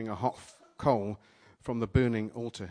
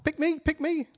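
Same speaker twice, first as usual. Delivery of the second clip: high, squeaky, eager volunteering; be the over-keen fish begging to be picked; Dory's eager shout; 4 dB louder than usual. Retold or imitated. Dory shouts to the shark, high and pleading. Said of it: "when Dory shouts to the shark".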